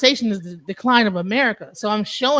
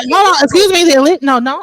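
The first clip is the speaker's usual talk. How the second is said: raised voice, cutting in; louder over the others to take the floor; loud interruption; 7 semitones higher, 9 dB louder than usual. Real, not acted. Interrupting to cut in.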